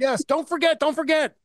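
high-pitched comic nagging; hammed-up urgent plea; mock-urgent reminder. Continues the mock pestering.

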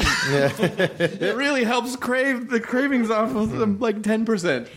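high, slow, laughing ad read; hammy pitchman delivery; commercial voice. Pretend. Mock ad line.

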